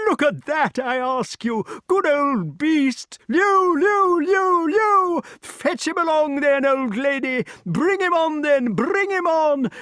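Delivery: very high huntsman's hallooing; high-pitched whooping king; excited yelling. A narrator's reading. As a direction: urge on a hound in high delight. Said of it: "yelled King Pellinore from behind his bars".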